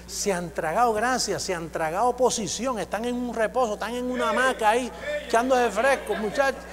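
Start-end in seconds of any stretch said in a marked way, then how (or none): none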